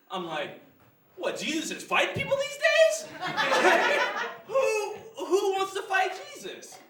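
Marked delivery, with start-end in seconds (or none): 1.2-4.2 high, loud disbelieving question; incredulous. Acted. Incredulous reaction to the sign. 4.5-6.8 slow, high-pitched disbelief; incredulous. Acted. Acting baffled at the sign.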